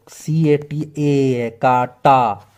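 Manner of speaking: slow, letter-by-letter spelling, then the word; deliberate letter-by-letter read-out. Shown as a demonstration. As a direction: spell out C-A-T-A slowly, then say काटा. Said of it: "काटा का spelling देखो".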